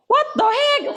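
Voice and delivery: high-pitched, exasperated outburst; mock outrage. Imitating Westerners hearing the teaching, in mock anger. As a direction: play up comic indignation, voice shooting up. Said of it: "화가 너무 난대요".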